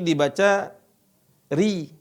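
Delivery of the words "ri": RI with a light r and pure i; thin, higher-pitched "ri"; ri tipis. Shown as a demonstration. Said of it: "itu tipis". (ucapkan "ri" tipis dengan i murni)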